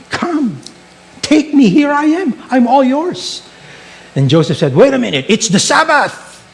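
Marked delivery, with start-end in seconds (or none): 0.1-3.4 high-pitched seductress act; enticing woman. Acted. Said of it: "that woman enticed him". 4.8-6.4 high-pitched comic objection; mock protest.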